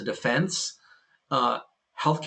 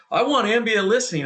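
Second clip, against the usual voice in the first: higher, louder retold demand; eager mock demand; 10 semitones higher, 5 dB louder than usual. Exec back from a conference demanding new tech, mock eagerness. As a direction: imitate someone sold on a new idea.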